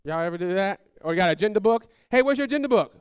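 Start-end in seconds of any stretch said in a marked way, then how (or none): 2.1-2.9 high, loud parental demand; parent calling out. Acted. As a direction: holler to a kid across the house.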